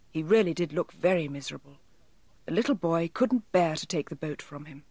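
usual speaking voice

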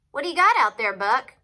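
loud, high colonel's question; calling out. A narrator's reading. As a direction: call across the camp. Colonel calls out to Buck.

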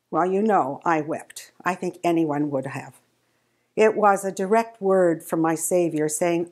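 normal talking voice

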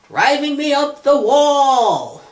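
very high, slow stretched-out phrase; exaggerated idiom. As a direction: wail the idiom in mock outrage.

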